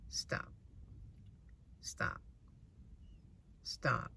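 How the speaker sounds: quiet, insistent stop; a hint of a stern God pleading, hushed; soft repeated stop. God warns the meddler, quietly repeating stop. God's repeated warning, retold softly.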